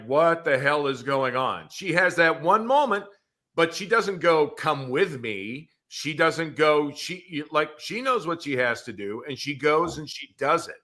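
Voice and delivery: ordinary delivery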